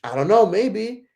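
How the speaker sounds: high, slow, hesitant put-on voice; mock unsure reply. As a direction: stall him with a sing-song maybe. Her hesitant answer voiced.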